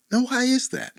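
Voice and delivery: soft, high, gently curious; right tone, curious. Shown as a demonstration. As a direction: ask gently with open interest. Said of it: "ask it with the right tone".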